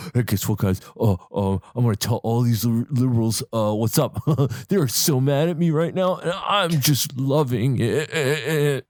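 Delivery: gloating internet troll, half laughing; smug troll voice. Retold or imitated. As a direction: crow over the mad liberals. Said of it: "he's there fucking jerking off going".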